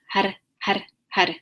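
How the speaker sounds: "har" with a short tongue-tip roll on the R. Shown as a demonstration. Teacher demonstrating the rolled R for students, crisply repeated. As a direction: tap out each "har", rolling the R. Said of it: "you're rolling that hr, that R".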